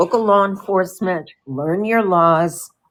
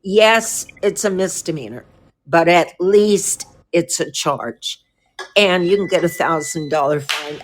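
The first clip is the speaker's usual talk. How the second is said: ordinary delivery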